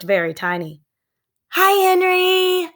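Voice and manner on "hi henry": high, loud, slow shouted greeting; shouting. A narrator's reading. Sister shouting to neighbor's dog, high loud slow. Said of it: "shouted Annie".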